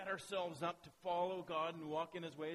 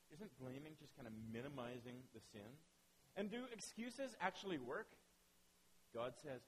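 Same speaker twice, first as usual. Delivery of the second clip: ordinary talk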